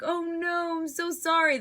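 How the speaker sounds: a hammed-up, high-pitched "I'm so sorry"; quoting Deadpool. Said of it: "And he's like".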